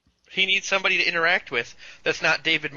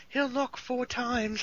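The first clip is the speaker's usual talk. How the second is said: slow, quieter, eerie voice; ominous prophecy; 8 semitones higher, 6 dB quieter, much slower than usual. Retold or imitated. Mocking the show's doom-laden prophecy, slow and hushed. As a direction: draw it out like a spooky omen. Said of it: "when she started talking like that".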